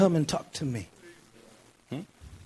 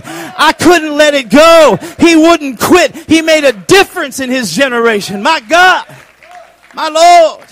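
loud, high, slow preacher's cry; shouted testimony building and then easing off; full-force preaching; 8 semitones higher, 16 dB louder than usual. Acted. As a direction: drive it home at full volume.

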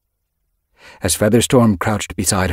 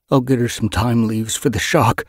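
usual speaking voice

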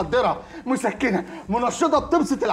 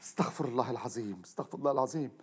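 muttered prayer; 9 semitones lower, 12 dB quieter than usual. Acted. Shafei asking God's forgiveness after the scandal, muttered prayer. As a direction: lower and much softer, under the breath.

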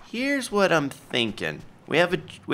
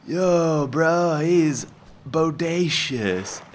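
surfer dude voice; 2 semitones higher, much slower than usual. Acted. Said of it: "surfer voice".

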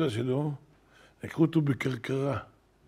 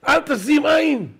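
shouted rebuke, loud and much higher; צעקה; 12 semitones higher, 9 dB louder, slower than usual. Retold or imitated. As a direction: cry it out at full voice, drawn out. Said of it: "הוא צועק". (בצעקה רמה)

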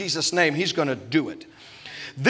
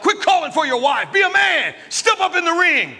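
high, loud scolding shout; shouting at Rocky; 10 semitones higher, 4 dB louder than usual. Retold or imitated. Preacher yelling at Rocky from the crowd, loud. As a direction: yell him back into the fight. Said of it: "I'm like".

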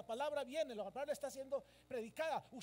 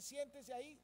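raised-pitch instruction with a lifted ending; quoted order; 2 semitones higher than usual. Acted. Usher telling a church member to sit, directing. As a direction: give it as someone else's instruction.

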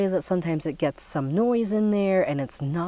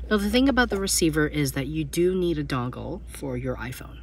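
ordinary talk

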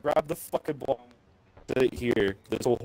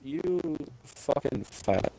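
slow muttered insult; 9 dB quieter, much slower than usual. Real, not acted. Repeating the insult to his teammate, slow and soft. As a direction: drag "You fucking fat" out slowly and softer.

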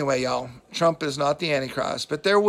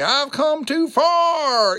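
much higher, louder and slow, a hammed-up put-on drawl; mocking impression, stretched out and pitched way up; mock Hillary preacher accent; 13 semitones higher, 7 dB louder, much slower than usual. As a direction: overdo a borrowed preacher cadence, slow and high.

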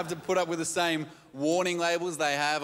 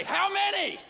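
mock yell; 14 semitones higher, slower than usual. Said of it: "they yell a number at you".

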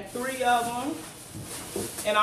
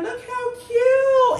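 gushing; 12 semitones higher, 6 dB louder, much slower than usual. Real, not acted. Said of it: "I'ma put this up in there".

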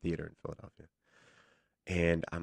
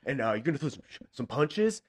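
hint of an upbeat instructor, pitched up; light imitation of a coach laying out moves, higher pitched; lightly voiced fight coordinator; 7 semitones higher than usual. Fight coordinator briefing nauseous actor, lightly imitated in a higher voice.

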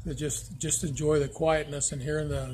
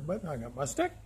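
usual speaking voice

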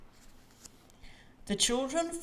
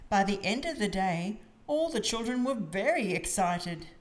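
plain reading voice

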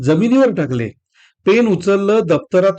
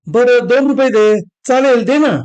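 put-on higher, louder begging like a child; pleading; 6 semitones higher, 3 dB louder than usual. A narrator's reading. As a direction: tug at her and beg. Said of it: "अतुलची विनवणी सुरू झाली".

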